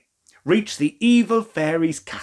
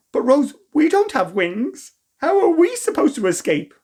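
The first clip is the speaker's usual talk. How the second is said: worried; 9 semitones higher than usual. Acted. Children worried, no wings.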